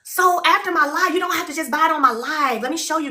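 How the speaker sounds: normal talking voice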